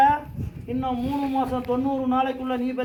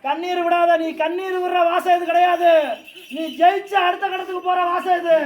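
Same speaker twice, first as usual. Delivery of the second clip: louder, higher command to stop crying; commanding shout; 4 semitones higher, 4 dB louder than usual. Acted. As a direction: order her firmly to stop the tears. Deity commands girl to stop crying.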